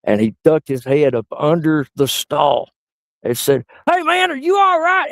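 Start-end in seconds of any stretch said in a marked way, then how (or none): 3.9-5.1 son's voice, pitched way up; small kid checking on a stranger; high child voice. Retold or imitated. Son peeking under the stall, high and sweet.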